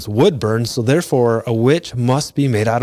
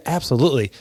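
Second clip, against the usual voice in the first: ordinary talk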